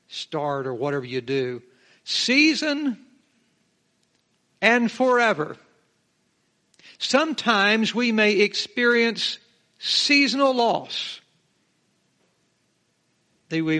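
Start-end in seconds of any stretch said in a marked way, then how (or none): 2.0-5.6 Season called out high and loud, then forever punched; stress on season and forever. Real, not acted. Preacher to his church, both words hit high and loud. 9.8-11.2 seasonal stretched slow, high and loud; stress on seasonal. Real, not acted. Preacher driving home the point, seasonal slow and high.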